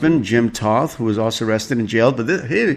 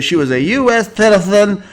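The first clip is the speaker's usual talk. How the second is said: high, loud mock drunk celebrity; shrill-free, pitched-up sneering quote; hammed-up indignant drunk woman; mocking drunk Reese; 11 semitones higher, 6 dB louder than usual. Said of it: "Quote to the officer".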